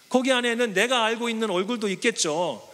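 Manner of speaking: ordinary delivery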